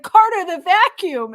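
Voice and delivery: high, loud, drawn-out call; mock battlefield shout; imitated yell. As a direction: yell an urgent order to a teammate, imitating. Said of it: "like, yelling at Carter".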